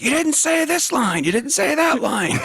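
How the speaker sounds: high, whiny protest; mock complaining listeners. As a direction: imitate indignant fans in a high voice. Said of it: "Right now, everyone's like".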